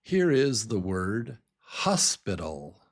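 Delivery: normal talking voice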